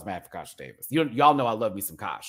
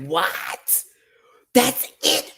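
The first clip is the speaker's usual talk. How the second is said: high, loud incredulous squeal; shocked disbelief; 6 dB louder, much slower than usual. Retold or imitated. Reacting to Kasha's small moment, shocked high squeal. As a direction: jump up in disbelief. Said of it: "I was like".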